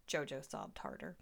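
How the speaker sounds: ordinary delivery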